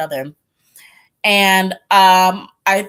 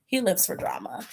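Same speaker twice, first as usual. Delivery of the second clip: whispered; 10 dB quieter than usual. Real, not acted. Vlogger gossiping to viewers, whispered. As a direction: repeat it in a whisper.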